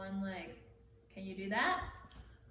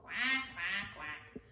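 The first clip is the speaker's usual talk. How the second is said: low mock quacking for kids; playful duck sounds; 4 semitones lower, slower than usual. Shown as a demonstration. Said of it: "what does a duck say".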